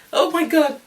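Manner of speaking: high, loud outburst; high-pitched disbelief at the pain; dramatic exclamation. Real, not acted. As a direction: exclaim it high, reeling from the memory. Recalling onset of labor pains.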